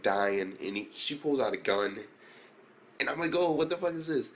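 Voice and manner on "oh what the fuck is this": mock shocked. Retold own shocked reaction.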